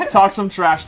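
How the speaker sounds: ordinary delivery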